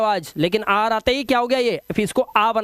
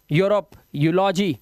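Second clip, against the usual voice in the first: ordinary delivery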